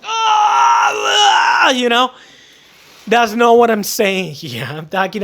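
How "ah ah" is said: high mock cry of pain. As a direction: yelp as your hand gets crushed. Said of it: "And you are like".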